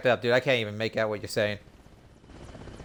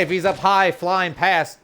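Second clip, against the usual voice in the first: calling out; 8 semitones higher, 6 dB louder, much slower than usual. Real, not acted. Calling out a helicopter.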